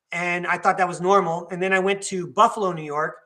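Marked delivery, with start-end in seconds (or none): none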